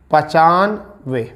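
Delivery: each syllable slowly drawn out; slow dictation. Shown as a demonstration. Teacher dictating numbers to students, slow and drawn out. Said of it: "इसे कहते हैं पचानवे".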